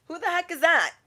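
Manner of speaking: high-pitched mock astonishment; baffled onlooker. Shoppers in line asking about a stranger, baffled. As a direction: ask it high and incredulous.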